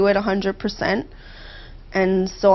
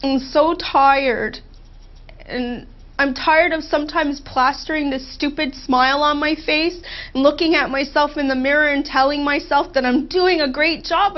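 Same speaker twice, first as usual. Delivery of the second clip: normal talking voice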